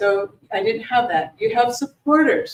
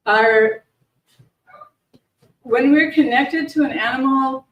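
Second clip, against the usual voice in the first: louder, projected speaking voice; projecting more; 3 semitones higher, 6 dB louder than usual. Real, not acted. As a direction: push the voice out to be heard. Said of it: "Maybe project more out".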